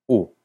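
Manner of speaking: U said as a clear Spanish u; a plain long u for Icelandic ú. Shown as a demonstration. Teacher shows Icelandic ú as a long u. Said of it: "se pronuncia así".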